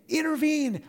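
Intervene. said high and loud, falling hard at the end; urgent plea. Acted. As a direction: beg God to step in, pushing the word Intervene. up high. Voicing David pleading with God.

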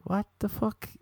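shocked disbelief. Retold or imitated. Incredulous reaction to neighbour.

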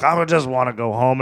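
normal talking voice

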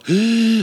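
sudden high startled gasp; sharp gasp. Real, not acted. Startled at home alone, a sharp gasp. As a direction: suck air in with a fright. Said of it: "I don't know why I'm gasping like that".

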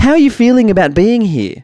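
mock TV host question; TV reporter voice. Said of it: "in her best current affairs slash getaway reporter voice".

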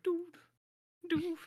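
high steady beeps; imitating a radar tattoo beeping; beeping radar noise. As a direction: mimic a radar ping, high and even. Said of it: "they're like".

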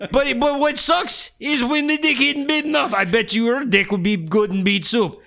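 high, hammed-up Russian accent. Skinny guy playing hard about beet soup, high mock Russian accent. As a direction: keep up the comic Russian tough talk, pitched high. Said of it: "doing a Russian accent".

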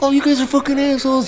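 very high fast bratty voice; high, fast mock brat; whiny kid insult. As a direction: squeal the insult while fleeing. Kid's parting insult.

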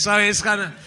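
normal talking voice

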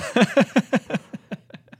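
high burst of laughter; laughing. Real, not acted. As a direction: crack up at your own story. Laughs at own retold quote.

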